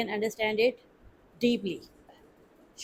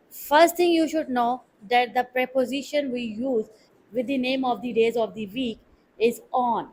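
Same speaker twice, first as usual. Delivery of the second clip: normal talking voice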